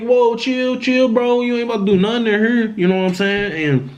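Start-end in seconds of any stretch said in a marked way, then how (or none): 0.0-2.6 high, hurried calming-down plea; acting out alarm, high but not shouted; retold urgent plea. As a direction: urgently talk someone down, pitched up. Retold plea to armed police.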